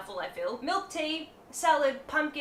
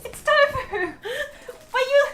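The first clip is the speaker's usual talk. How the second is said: high, loud, slow shout through laughter; yelling while cracking up; laughing shout; 10 dB louder, slower than usual. Real, not acted. Friend laughs at her tofu plate.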